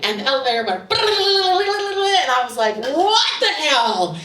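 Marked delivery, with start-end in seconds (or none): 0.9-2.2 long high trilled brrrr; drawn-out, high rattling noise; imitated elevator rattle. Storyteller mimics the elevator hitting the springs, high trilled rattle. 2.8-4.2 high, drawn-out cry of alarm; panicked outcry. Retold or imitated. Reacting to the elevator hitting the springs, panicked drawn-out cry.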